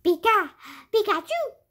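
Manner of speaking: high, loud squeaky Pikachu cry; Pikachu impression. Kid shows viewers his Pikachu impression, high squeal. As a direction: squeak it out like the cartoon mouse. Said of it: "a good Pikachu impression".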